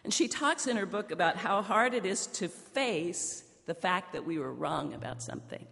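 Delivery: ordinary delivery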